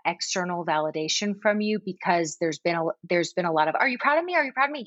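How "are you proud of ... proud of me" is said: put-on higher, eager little kid asking; child voice. Mom imitating her daughter begging for praise, higher. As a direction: pitch up like a pleading small child.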